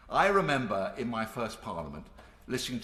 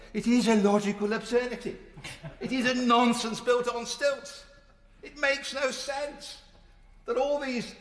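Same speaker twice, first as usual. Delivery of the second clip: imitating Enoch Powell; 10 semitones higher, 4 dB louder than usual. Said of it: "as he would have said, and I can't do the Birmingham accent".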